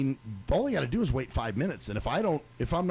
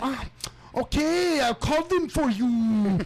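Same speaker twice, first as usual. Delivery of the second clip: high, loud, slow bored drawl; gum-snapping receptionist; 10 semitones higher, 4 dB louder, much slower than usual. Retold or imitated. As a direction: play the gum-chewing call agent. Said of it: "gum snapping Q Vivo bitch".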